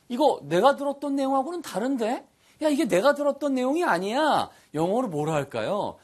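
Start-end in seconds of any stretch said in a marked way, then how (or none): 0.1-4.5 raised, quick, indignant speaker; mock complaint. Teacher playing an annoyed customer in a lesson. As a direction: act out a puzzled complaint in a higher voice.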